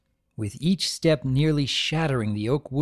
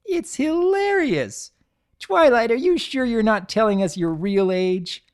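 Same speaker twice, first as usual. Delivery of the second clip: high, mocking tease barely holding laughter; poking fun at her friend; teasing amusement; 10 semitones higher, 5 dB louder than usual. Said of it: "failing to hold back her amusement".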